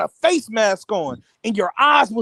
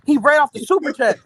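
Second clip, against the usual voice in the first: ordinary talk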